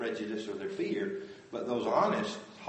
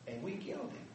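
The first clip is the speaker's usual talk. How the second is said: very soft, broken-off confession; stricken whisper-like admission; 7 semitones higher, 12 dB quieter, faster than usual. Retold or imitated. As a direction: let it fall away in shame. Realization of guilt.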